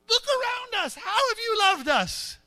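put-on high, drawn-out cynical teen; high-pitched rebellious whine; oozing teenage attitude. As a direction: let the jaded attitude ooze out slowly. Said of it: "their attitude just oozes out".

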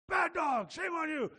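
hammed-up owner scolding the dog; mock scolding shout. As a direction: bark it at the naughty dog, playfully. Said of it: "change your voice".

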